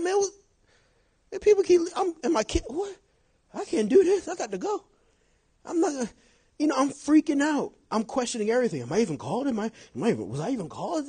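high-pitched, mock-panicked self-questioning; freaking out. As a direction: retell a meltdown, questions tumbling to a high peak.

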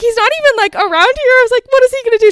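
high, loud mock fluster; retold fluster. Telling how she doubted calling dad, flustered. Retold frantic phone call to friend.

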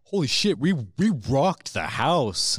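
high, loud, slow hammed-up brag; mock excited band. Mocking band after the show, loud high brag. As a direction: shout it up like a smug rocker. Band backstage bragging mocked.

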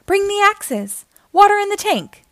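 high-pitched brisk orders; urgent calling. A narrator's reading. Firefighters call for their gear, high urgent calling. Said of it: "The firefighters call".

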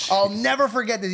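ordinary talk

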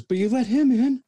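very high, loud mock-female voice; whining fan protest. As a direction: squeal a hammed-up complaint at the guard. Screaming lady protests to security.

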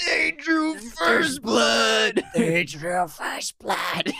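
overacted action-hero shout, drawn out; Rambo impression. Teasing his co-host with a hammed-up Rambo line. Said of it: "I was trying to do Sly".

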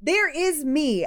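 ordinary delivery

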